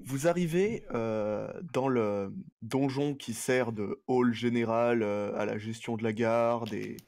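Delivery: ordinary delivery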